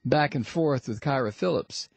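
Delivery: ordinary delivery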